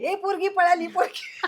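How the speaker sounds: high laughing mock yell of the crowd; mock crowd shout. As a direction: shout it like a crowd spotting a runaway girl, while laughing. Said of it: "सगळे लोकं ओरडायला लागली". (पळून जाणाऱ्या पोरीला पाहून ओरडणारी गर्दी, हसत केलेली नक्कल)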